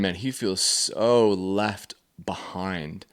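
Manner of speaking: ordinary talk, nothing marked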